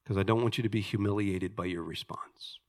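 whispered aside to the congregation; hushed, teasing aside. Real, not acted. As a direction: drop to a confiding whisper. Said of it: "Don't answer out loud".

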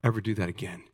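low, hushed stern warning; a quiet, stern Jesus voice, no yelling; mild stern rebuke. Retold or imitated. Jesus rebuking disciples who turned children away, low and stern. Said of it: "Jesus gets enraged".

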